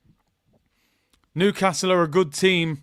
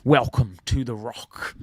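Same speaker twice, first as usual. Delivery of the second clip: put-on low, slow Scottish film-star accent; measured, lower-pitched Scottish impression; Sean Connery; 7 semitones lower than usual. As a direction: do a Scottish screen-legend impression, drawn out. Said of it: "That was my Sean Connery accent".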